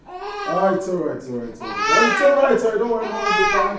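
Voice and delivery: tearful, pleading comfort; crying reassurance. Acted. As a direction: soothe him while your own tears spill over. Consoling while breaking down herself.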